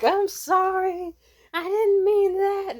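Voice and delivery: high, slow, drawn-out apology; mock apology. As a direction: plead sorry in a sing-song. Apologizing after the slip.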